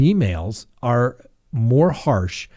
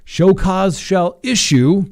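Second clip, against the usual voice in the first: higher, louder, slow judge's pronouncement; stern judge ruling; 7 semitones higher, 5 dB louder, much slower than usual. Retold or imitated. Lawyer quoting the judge ordering show cause, slow and loud. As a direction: hand down the order slowly. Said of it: "came back down and said".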